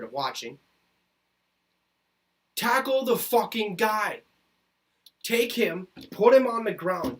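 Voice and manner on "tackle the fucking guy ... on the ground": raised, slow, forceful barking of tackling orders; angry yelled order. Real, not acted. Fan yelling at his team's defenders, angry and forceful. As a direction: yell it at the defense like a fed-up fan.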